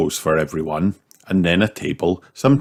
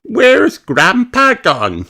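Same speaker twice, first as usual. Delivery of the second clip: Peppa voice; 5 dB louder, slower than usual. A narrator's reading. Peppa asks.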